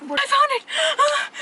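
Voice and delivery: high, delighted shriek; finally finding the screw, high happy squeal; triumphant squeal. Real, not acted. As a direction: squeal with relief and joy. Finds the lost screw.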